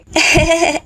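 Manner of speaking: a short, pleased little giggle; sly giggle. Acted. Girl giggling after sneaking the phone into her bag. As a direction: giggle at getting away with it.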